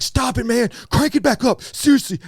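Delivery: high, loud imitation of alarmed friends; urgent mock pleading. Retells friends' panicked protest.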